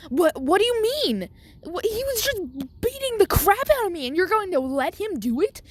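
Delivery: high, outraged disbelief, voice up high; can't believe what he just heard; shocked disbelief. Acted. Deku shocked at teacher.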